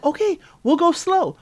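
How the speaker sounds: high-pitched mock reassurance; placating boyfriend. Acting out his placating reply.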